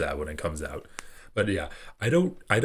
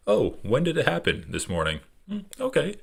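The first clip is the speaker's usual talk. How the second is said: mock politician; 7 semitones higher than usual. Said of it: "perfect reaction".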